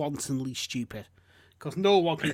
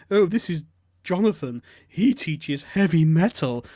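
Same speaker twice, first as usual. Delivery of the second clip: loud, hammed-up introduction, last words stretched; mocking advert woman; 8 dB louder, slower than usual. Man mimicking the advert woman introducing Jonathan, mocking. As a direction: imitate her dim, proud announcing. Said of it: "she's like".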